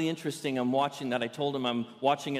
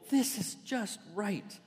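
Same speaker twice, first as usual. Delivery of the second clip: slow, softer, higher Goldilocks line; Goldilocks tasting porridge; 5 semitones higher, 5 dB quieter, slower than usual. Acted. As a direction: savour it slowly, lightly in character. Voices Goldilocks in retold story.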